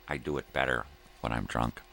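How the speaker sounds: ordinary delivery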